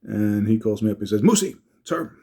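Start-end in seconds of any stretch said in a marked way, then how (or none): 1.2-2.2 lightly voiced older friend, higher; a hint of Herm's raised phone greeting; imitating Herm. Old friend calls on the phone, lightly voiced greeting.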